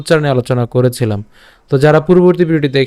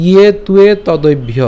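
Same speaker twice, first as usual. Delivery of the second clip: শ্লোক recitation; 3 semitones higher, 3 dB louder than usual. Shown as a demonstration. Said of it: "প্রথম থেকে বলি". (ধীরে টেনে টেনে শ্লোক পাঠ)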